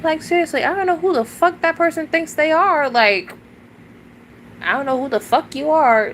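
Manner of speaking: real strained fury; furious rant. Real, not acted. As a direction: vent the fury upward. Furious rant after sigh.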